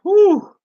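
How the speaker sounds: high, loud "woo" of delight; delighted whoop. Real, not acted. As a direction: whoop over a great meal. Savoring homemade lasagna dinner.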